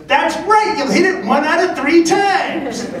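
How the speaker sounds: very high, emphatic coach's approval; Ted Williams. Retold or imitated. Baseball legend confirming pitcher's answer, very high emphatic impression. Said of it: "He goes".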